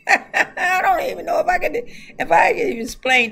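high, loud, breaking into laughter; can barely get it out for laughing; laughing through words. Real, not acted. Laughs at her own talk.

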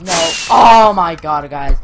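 loud, drawn-out cry of dismay; dismayed outcry. Real, not acted. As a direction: cry out loudly as you lose the game. Player has just been killed.

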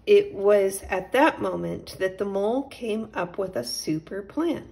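plain reading voice

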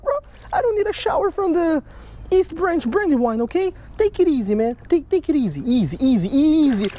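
calm a splashing fish like a skittish horse; soothing the fish. Real, not acted. Angler coaxing a splashing fallfish in a high, soothing voice.

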